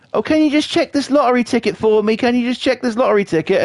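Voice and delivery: high, nagging mock voice of a customer; high pushy stranger pestering at the shop. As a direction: whine it like an annoying shopper. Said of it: "there's these fuckers, you know".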